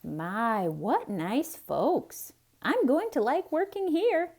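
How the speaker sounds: lightly voiced cheerful maid; a hint of an eager housemaid; Amelia Bedelia voice. A narrator's reading.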